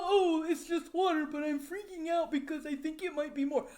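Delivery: lightly voiced whiny vlogger, a touch of fake crying; mocking whine. Mocking the vlogger's worried words in a mild whiny voice. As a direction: mimic him as a mild mock whimper. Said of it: "look at your belly and go".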